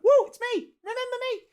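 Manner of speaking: high mock "woo" voice; squealed attention-seeking audience member, high-pitched; imitation heckler. Annoying audience member whooping at a stand-up show, high mock squeal. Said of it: "it's almost like".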